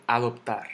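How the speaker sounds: "adoptar" stressed on TAR; final-syllable stress. Shown as a demonstration. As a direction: land the accent on the last syllable of "adoptar". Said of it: "que cae en la última sílaba".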